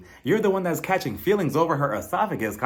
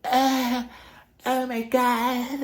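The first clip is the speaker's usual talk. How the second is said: high, slow mock moan in a fake accent; exaggerated put-on accent, drawn-out fake ecstasy; Dorit; 10 semitones higher, much slower than usual. Imitating castmate's orgasm face, fake-accented moan. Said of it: "her orgasm face had a fake accent".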